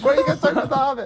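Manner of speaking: high, rushed quoted question; excited outburst. Retold or imitated. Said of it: "I was like".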